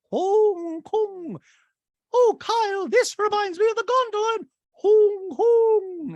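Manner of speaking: high, loud gushing lady; Dorit impression. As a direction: gush it like Dorit. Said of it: "She goes".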